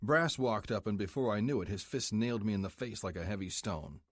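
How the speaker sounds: ordinary talk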